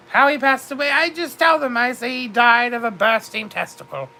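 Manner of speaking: usual speaking voice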